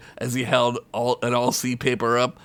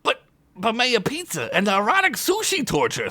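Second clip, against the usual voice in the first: high whining plea; whiny goblin voice; goblin whine; 10 semitones higher than usual. Acted. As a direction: beg the orc to stay. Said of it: "Skunk whined".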